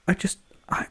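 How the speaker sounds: put-on nervous kid whispering "I just, I"; a timid fan's hushed start; whispered kid. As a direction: breathe it out like a shy kid. Kid nervously asks for photo.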